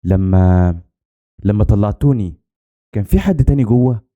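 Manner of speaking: hesitant, with a pause after a drawn first word; falter, restart, then get the question out; broken, halting voice. A narrator's reading. Rescued girl asking who else was inside, halting. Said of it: "سألت بصوت متقطع".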